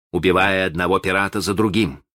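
ordinary delivery

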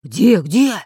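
excited pirate outburst; startled eagerness. A narrator's reading. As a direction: jump up asking urgently. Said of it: "вскочил рыжебородый толстый пират".

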